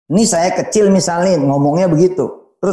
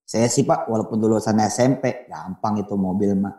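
mock modest mechanic; 9 semitones lower, 6 dB quieter than usual. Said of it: "Terus kita ngomong tawadu nih".

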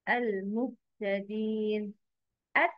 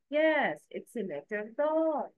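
ordinary delivery

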